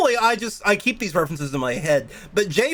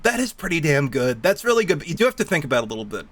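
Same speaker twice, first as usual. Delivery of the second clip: ordinary talk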